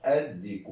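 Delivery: ordinary talk, nothing marked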